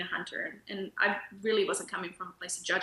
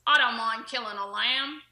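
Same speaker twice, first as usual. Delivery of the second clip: louder, put-on Australian farmer accent; 3 semitones higher, 5 dB louder than usual. Audience member challenging the speaker at a talk, mock Australian accent. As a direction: heckle from the crowd in an Australian accent. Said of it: "in my Australian accent".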